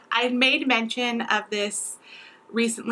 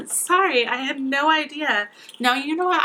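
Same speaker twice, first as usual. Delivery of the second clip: teary apology with a laugh, voice pitched up; tearful; 5 semitones higher than usual. Real, not acted. Apologising to viewers while crying and laughing. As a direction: laugh through real tears. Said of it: "I'm crying".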